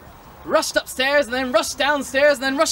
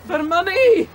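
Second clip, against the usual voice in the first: high, louder sailor voice; squeaky sailor shout; 8 semitones higher, 6 dB louder, slower than usual. Acted. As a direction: blurt it out in a high silly voice. Sailor yelling catchphrase.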